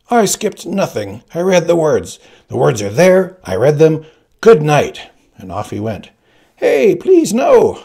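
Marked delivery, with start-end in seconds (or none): 0.1-5.1 louder, clipped-off indignant insistence; upset father. Retold or imitated. Father refusing his son's correction, louder and indignant. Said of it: "he was more than a teeny bit upset". 6.6-7.8 very high, loud pleading call; calling after. Retold or imitated. Boy calling after his father leaving the room, very high and loud. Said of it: "I called after him".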